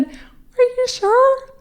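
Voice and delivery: high, slow, drawn-out Are you sure; a stunned bride questioning her doctor's news; stunned disbelief. Retold or imitated. High, slow, stunned question to the doctor. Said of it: "And I said".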